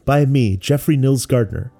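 usual speaking voice

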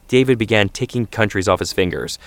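plain reading voice

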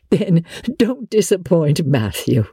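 plain reading voice

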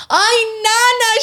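high, loud mock wail; mock crying mom. Mom crying over her daughter, mock high wail. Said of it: "she started crying".

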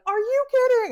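high, loud mock disbelief; incredulous staff. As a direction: imitate staff squealing in disbelief. Staff's retold shocked reaction.

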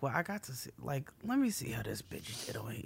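hushed whisper, no voice. Retold or imitated. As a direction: whisper it like a secret. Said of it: "And I was like".

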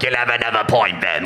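low mock-Cockney pirate-tavern voice; Cockney bar wench. Bar wench serving pirates in a tavern, Cockney accent. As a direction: play a rough British bar wench. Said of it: "like a bar wench from Pirates of the Caribbean".

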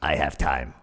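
low, inviting murmur-like tone; imitating a flirty stranger's deep voice; seductive woman. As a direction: offer it deep and suggestive. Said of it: "just that kind of voice".

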